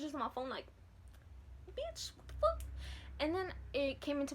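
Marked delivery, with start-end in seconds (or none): 1.8-2.6 high squeaky what-the-fuck; freaked-out high squeal; shocked squeal. Retold or imitated. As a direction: squeal it out in disbelief. Panicked reading symptoms on phone.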